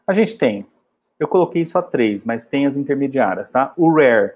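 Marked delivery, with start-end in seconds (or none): 3.8-4.3 rare said with a strongly rolled R; rare, R bem enrolado. Shown as a demonstration. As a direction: curl the R in rare. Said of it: "olha essa pronúncia".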